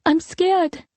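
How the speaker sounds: put-on very high scared little-girl voice; child's whimper. A narrator's reading. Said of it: "Liliane whimpered".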